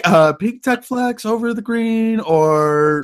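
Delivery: mock dreamy voice. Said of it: "He's like".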